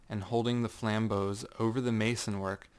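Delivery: ordinary delivery, nothing marked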